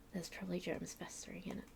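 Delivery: unvoiced disgusted whisper. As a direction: hiss it to yourself in disgust.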